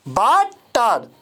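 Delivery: ordinary talk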